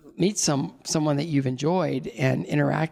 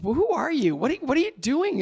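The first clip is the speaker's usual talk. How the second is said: higher, incredulous questions to yourself; a mild panicked inner voice; mock self-scolding; 9 semitones higher than usual. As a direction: ask yourself what on earth you're doing. Scolding himself mid-blunder.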